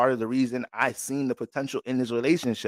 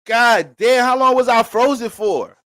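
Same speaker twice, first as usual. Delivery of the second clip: mock old neighbor; 9 semitones higher, 8 dB louder, slower than usual. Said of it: "They used to just come and be like".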